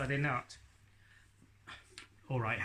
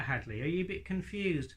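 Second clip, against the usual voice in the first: usual speaking voice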